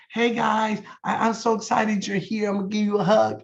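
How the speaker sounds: a light imitation of an over-eager friend; gushing partner imitation, mildly hammed; imitating Jesse. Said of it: "Jesse will come out and go".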